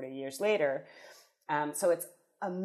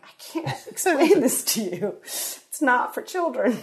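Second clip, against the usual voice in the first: higher, laughing, can't quite get it out; a half-laughing, half-crying mock plea, raised in pitch; laughing protest; 8 semitones higher, 6 dB louder than usual. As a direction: laugh through a helpless refusal. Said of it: "I'm like".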